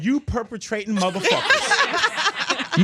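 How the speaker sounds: slow, loud put-on indignation; mock outrage. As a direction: imitate an indignant guest, hammed up and laughing. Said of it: "she was probably about".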